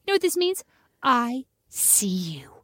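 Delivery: spook her, slow on the last words; creepy kid. Retold or imitated. Mother imitating her son's creepy bedtime stare, slow and drawn out. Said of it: "He starts doing the fingers".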